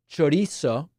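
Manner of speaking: chorizo with a Spanish tapped r and an s sound; chorizo as cho-REE-so, Spanish style; Spanish pronunciation. Shown as a demonstration. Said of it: "or said in an American accent".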